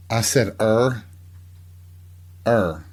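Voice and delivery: "er" said like "air" in a Southern drawl; Southern er for our. Shown as a demonstration. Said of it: "I do have a little bit of a Southern accent".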